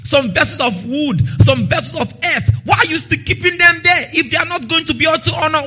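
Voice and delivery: ordinary talk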